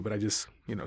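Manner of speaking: ordinary delivery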